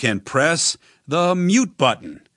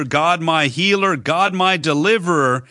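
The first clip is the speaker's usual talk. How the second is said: ordinary talk, nothing marked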